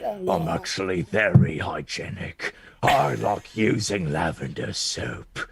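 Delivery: low, slow, deliberate delivery; character speaking in combat. Acted. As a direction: say it low and unhurried, very composed. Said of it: "I'll say".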